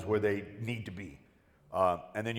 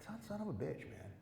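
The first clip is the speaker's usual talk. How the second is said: very soft, higher-pitched grumble; retold muttered curse; 9 semitones higher, 14 dB quieter than usual. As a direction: curse under your breath, barely audible. Muttering while hidden behind pillar.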